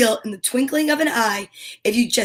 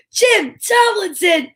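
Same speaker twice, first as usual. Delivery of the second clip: excited announcement; 7 semitones higher, 4 dB louder, slower than usual. Real, not acted.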